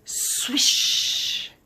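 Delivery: loud, long hissing swish; imitated swish. A narrator's reading. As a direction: make the rushing sound of skis on snow. Narrator imitates skis sailing down.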